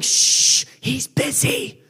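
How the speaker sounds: whispered scolding, drawn out; disciples hushing. Acted. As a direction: hush someone with a slow stage whisper. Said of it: "They're like".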